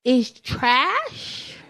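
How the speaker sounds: lightly voiced curious child; grandbaby's question. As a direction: imitate a toddler checking a word. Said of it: "he speaks Spanish".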